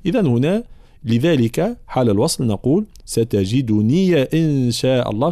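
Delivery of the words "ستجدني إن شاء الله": ستجدني with the final ya voiced as "ya" before إن; slow recitation linking ستجدنيَ إن; ya with fatha. Shown as a demonstration. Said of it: "نحركها بالفتح". (ستجدنيَ إن بفتح الياء)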